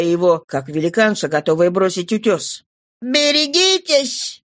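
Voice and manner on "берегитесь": high, drawn-out warning cry. A narrator's reading. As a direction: pitch it high and stretch out the warning. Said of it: "крикнула она".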